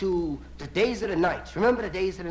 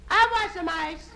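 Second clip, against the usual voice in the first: high, slow, drawn-out woman's call; woman yelling down; 11 semitones higher, 3 dB louder, slower than usual. Acted. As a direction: call down from an upper floor. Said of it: "She yells down to the guy".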